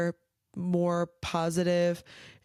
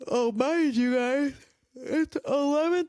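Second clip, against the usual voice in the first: yawning; 8 semitones higher than usual. Real, not acted. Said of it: "so sorry for the yawning".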